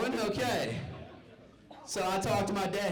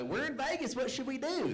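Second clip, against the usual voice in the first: high, excited mock tourist voice; clueless tourists; 10 semitones higher than usual. Comic mocking visiting family, high mock-eager voice. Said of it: "the whole thing".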